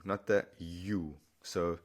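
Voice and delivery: ordinary talk, nothing marked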